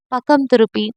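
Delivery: usual speaking voice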